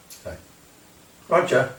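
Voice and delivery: ordinary delivery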